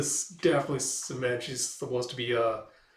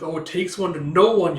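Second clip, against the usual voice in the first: louder retort, higher, mock spite; snippy comeback; 5 semitones higher, 10 dB louder than usual. She snaps back at the insult, louder.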